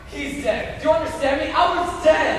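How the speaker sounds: loud, high, hammed-up cry; melodramatic shout. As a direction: hurl it like stage melodrama. Melodramatic lovers' quarrel.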